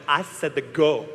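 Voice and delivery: a hint of a bored French starter. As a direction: starter dismissing the racer, light French accent. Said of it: "held up the stopwatch and went".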